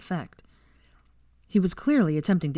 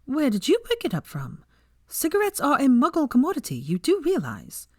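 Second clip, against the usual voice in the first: put-on a much higher young woman's voice, listing dangers; higher-voiced heroine lecturing, then sheepish; Hermione, lecturing; 9 semitones higher than usual. A narrator's reading. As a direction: rattle off the risks in a high, prim voice. Hermione questioning Malfoy.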